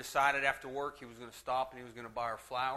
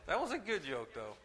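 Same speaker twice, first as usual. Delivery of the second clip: laughing; 3 semitones higher than usual. Real, not acted. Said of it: "I'm sorry".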